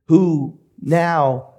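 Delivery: ordinary delivery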